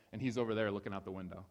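normal talking voice

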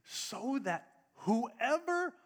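slow it down before the key word; drawn-out slow. Shown as a demonstration. Preacher building suspense before the verb, drawn out. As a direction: stretch so that whoever very slowly. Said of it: "here's the word".

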